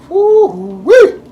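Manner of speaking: high, loud delighted woo-wee; excited whoop. Real, not acted. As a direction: let out a big happy holler. Exclaiming over the food.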